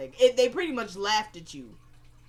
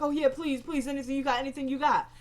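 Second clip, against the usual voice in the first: higher-pitched mock pleading; 4 semitones higher than usual. Man mimicking his pleading captive, mocking. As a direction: imitate someone begging, to make fun of them. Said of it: "the dude's mocking him".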